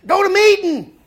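shouted advice to the TV character; yelling at the screen. Retold or imitated. Husband and wife yelling at a movie heroine, loud shout. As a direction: bellow it at the movie. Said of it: "the first thing we go is".

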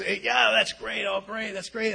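high, drawn-out imitation of delight; all excited. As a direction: imitate someone thrilled by great news. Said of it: "Buddy was all excited".

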